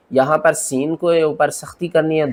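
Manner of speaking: normal talking voice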